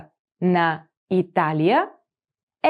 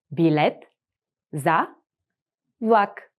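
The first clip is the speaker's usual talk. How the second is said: Билет, за, влак, each set apart by pauses; slow, split Билет за влак; word by word; 3 semitones lower than usual. Shown as a demonstration. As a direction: break Билет за влак into separate words. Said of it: "teacher splits phrase word by word".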